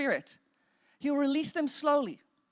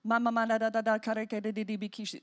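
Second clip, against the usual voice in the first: tongues syllables demo; 4 semitones lower, faster than usual. Shown as a demonstration. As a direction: rattle off simple syllables and let them die away. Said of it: "the sound of tongues is very".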